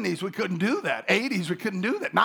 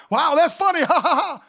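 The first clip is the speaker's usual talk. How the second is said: high, loud laughing reaction; loud, high mock laugh; 8 semitones higher, 6 dB louder than usual. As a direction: laugh along at the funny video. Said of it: "Everyone's like".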